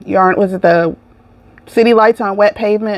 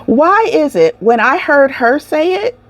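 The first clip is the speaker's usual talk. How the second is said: ordinary delivery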